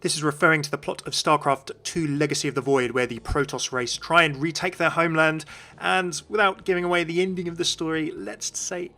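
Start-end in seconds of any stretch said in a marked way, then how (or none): none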